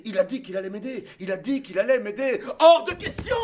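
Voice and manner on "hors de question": cri aigu et fort de mère; mère qui crie. Retold or imitated. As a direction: refuse en criant, voix haute. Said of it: "dit ma mère". (la mère crie son refus, aigu et fort)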